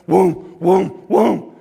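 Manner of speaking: three loud whooms; imitated punch whooshes. Opponent comes on swinging, loud imitated whooshes. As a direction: sound out heavy swinging gloves.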